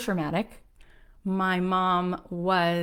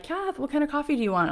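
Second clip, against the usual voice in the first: high, quick, chirpy question; imitated coffee offer; 8 semitones higher, faster than usual. Producer asking Kath about coffee, high and quick. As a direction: mimic a quick, casual offer in a higher voice.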